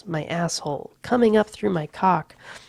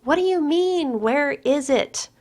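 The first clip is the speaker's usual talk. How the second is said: much higher, puzzled calling; calls out; 9 semitones higher than usual. A narrator's reading. Courtney calling out baffled to Patrick, much higher. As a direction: call it out, baffled. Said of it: "she calls out".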